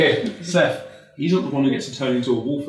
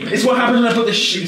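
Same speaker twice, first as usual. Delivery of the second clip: ordinary talk, nothing marked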